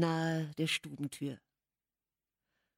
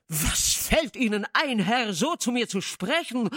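louder, higher outraged demand; thundering; 5 semitones higher, 6 dB louder than usual. A narrator's reading. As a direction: Heathcliff thunders at his guest. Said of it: "donnerte Heathcliff".

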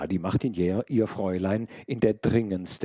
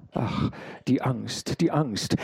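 anxious gasping; 5 semitones higher than usual. A narrator's reading. Shaken servant tells of his fear.

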